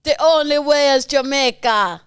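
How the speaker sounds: Jamaican accent. Shown as a demonstration. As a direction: attempt a Jamaican accent, drawn out. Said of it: "it was more Jamaican".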